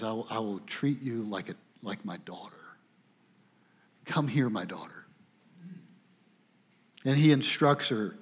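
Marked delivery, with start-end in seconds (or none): 0.0-4.9 much softer and lower, gentle reassurance; tender. Retold or imitated. Boaz welcoming Ruth as his daughter, soft lowered tender voice. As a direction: speak it softly like comforting a child. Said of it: "he tenderly cares for her".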